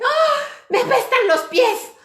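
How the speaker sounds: high, loud mock outburst; hammed-up, high-pitched startling cry; sudden disruptive shout. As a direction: yell it out of nowhere to derail an argument.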